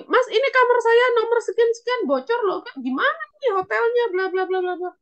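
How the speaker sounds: high-pitched, agitated mock complaint; complaining guest. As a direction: play a hotel guest fussing about a leak. Acts complaining hotel guest.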